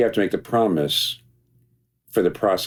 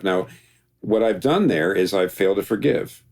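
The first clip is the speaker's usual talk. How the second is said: ordinary talk, nothing marked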